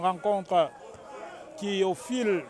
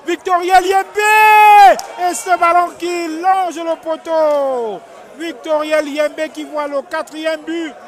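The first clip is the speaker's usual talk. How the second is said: building to a loud, high, stretched-out shout, then easing; esports caster; 6 semitones higher, 9 dB louder than usual. Real, not acted. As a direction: call the near-miss at full pitch. Near miss in live commentary.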